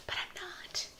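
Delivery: soft teasing whispered aside; playful whispered confession; cheeky whisper. Real, not acted. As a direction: confide the joke in a whisper.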